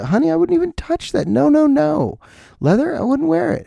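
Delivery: high-pitched mock vegan voice; preachy vegan. Comic mimicking a vegan refusing leather, high and preachy. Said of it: "And vegans will tell you".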